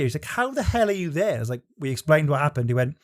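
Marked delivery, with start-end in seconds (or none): 0.2-1.4 retold incredulity. Retold restaurant son's disbelief.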